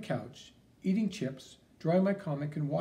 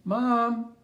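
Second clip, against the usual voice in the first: calling out; 6 semitones higher, 6 dB louder than usual. Acted. Calling out for mom at home.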